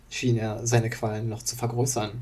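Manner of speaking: usual speaking voice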